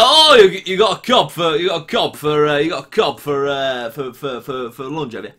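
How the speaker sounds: mock Leicester lad, starting high and fading; lightly imitating a Leicester schoolmate, first words very high; light Leicester accent. Imitating a new classmate from Leicester, light mock Leicester accent. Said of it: "from Leicester".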